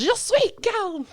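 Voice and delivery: high, slow, exaggerated French accent; mock French. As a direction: drawl it like a bad French actress. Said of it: "And then she says".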